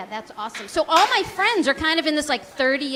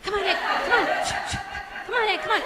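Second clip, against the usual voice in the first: higher, pleading come on egg; urgent CPR coaxing; 7 semitones higher, faster than usual. Pretend. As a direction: coax a dying egg back to life. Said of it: "do CPR on the egg".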